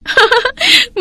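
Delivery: very high gloating laugh; loud laughter. Acted. Proud bride laughs loudly at the empty rival table. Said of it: "जोर जोर से हंसने लगी".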